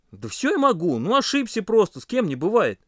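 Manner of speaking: high-pitched defensive self-justification; making excuses. A narrator's reading. Pasha backs away from angry friends, making excuses. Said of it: "пытался оправдаться Паша".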